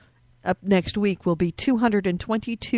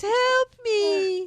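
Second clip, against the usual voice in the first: damsel voice; 11 semitones higher than usual. Retold or imitated. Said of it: "you're the damsel in fucking distress".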